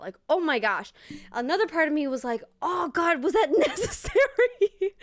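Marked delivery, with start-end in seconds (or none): 2.6-4.9 high, laughing disbelief; mock exasperation. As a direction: groan it out in amused dismay. Acted exasperated reaction to dialogue.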